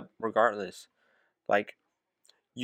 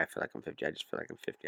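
mumbled aside; 5 semitones lower, 10 dB quieter, faster than usual. Real, not acted. Muttered aside.

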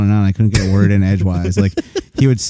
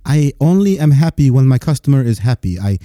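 put-on higher-pitched, effusive Indian-accented agent; 4 semitones higher than usual. Fios agent thanks his customer on the line, eager Indian accent. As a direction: gush politely in an Indian accent. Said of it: "he was, like, going off on".

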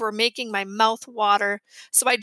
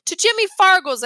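defiant reply; 7 semitones higher, 6 dB louder than usual. A narrator's reading. Boy announcing where he goes.

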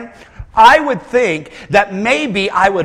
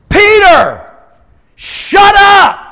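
much higher, loud, snapping shout; blunt rebuke shout; 14 semitones higher, 10 dB louder, much slower than usual. Retold or imitated. God silencing Peter, high loud shout. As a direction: cut Peter off sharply. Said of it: "God says".

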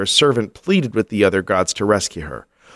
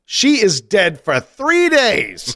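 mock outrage; 10 semitones higher, slower than usual. Mocking husband's cheating, indignant.

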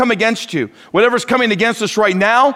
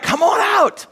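much higher, bright invitation from Jesus; Jesus inviting; 13 semitones higher than usual. Retold or imitated. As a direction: call him out warmly, high. Jesus invites Peter onto water.

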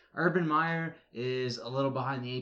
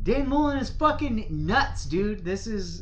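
sudden high, loud exclamation; loud outburst; 8 semitones higher, 8 dB louder than usual. Real, not acted. Co-host giving his hot take on the Florida coach, loud outburst. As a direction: blurt it out in loud, high-pitched disbelief.

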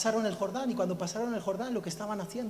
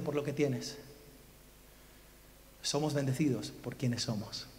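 low, measured pulpit gravity; hushed, earnest appeal to the congregation; solemn preaching; 5 semitones lower than usual. Real, not acted. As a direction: let each phrase settle before the next.

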